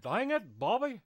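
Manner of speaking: Texan cartoon dad with tongue bent back; back-of-the-mouth exasperated catchphrase; Hank Hill. Shown as a demonstration. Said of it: "bend your tongue back".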